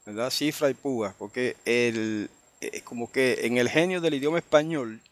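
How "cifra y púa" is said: CIfra y PÚa, weight on the first syllables; Spanish-style stress. Shown as a demonstration. Teacher repeating the common Spanish way, Cifra y púa, first-syllable stress. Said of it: "muchos hermanos dicen".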